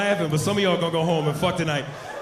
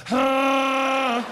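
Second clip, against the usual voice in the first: long, high imitated Wookiee wail; Chewbacca; 5 semitones higher than usual. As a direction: hold a hairy-beast howl. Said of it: "I was like Chewbacca from Star Wars".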